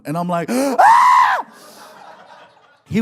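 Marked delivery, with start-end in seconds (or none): 0.5-1.5 high loud delighted scream; joyful shriek. Retold or imitated. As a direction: shriek with relief.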